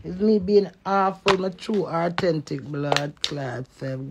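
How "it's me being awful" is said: usual speaking voice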